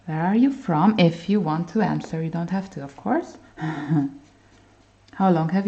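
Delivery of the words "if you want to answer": ordinary talk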